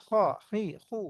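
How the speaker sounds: "খ, হি, হু" each with a heavy Arabic kh; thick back-of-tongue friction on "খ, হি, হু"; heavy kha. Shown as a demonstration. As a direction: drill "খ, হি, হু" as full, heavy kh sounds. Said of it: "ভারী হবে".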